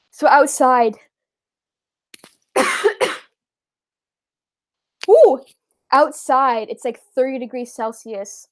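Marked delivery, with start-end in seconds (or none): 2.5-3.2 sharp dry cough from an itchy throat; short dry cough. Real, not acted. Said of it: "my throat is itchy".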